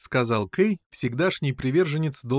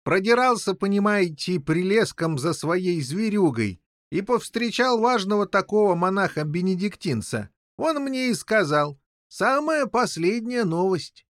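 higher-pitched king recounting an encounter; eager old king, telling news; 8 semitones higher than usual. A narrator's reading. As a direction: tell your news in a high character voice. (взволнованный король рассказывает новость)

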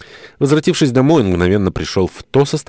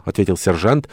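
usual speaking voice

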